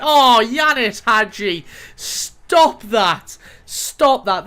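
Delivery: loud, slow, delighted groan of disbelief; excited outburst. Real, not acted. Player reacting to a stunning goal, loud and thrilled. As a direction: shout at the screen in delight. Goal replay, commentator gushing.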